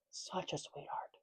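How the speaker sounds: very soft, almost whispered aside; hushed cooing. Real, not acted. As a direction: coo it under your breath. Cooing repeat, nearly whispered.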